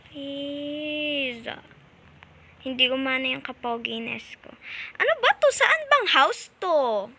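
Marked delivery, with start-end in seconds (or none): 5.0-7.1 high, loud exasperated cry; frustrated yell. Real, not acted. Gamer yelling at the stuck game, frustrated shout.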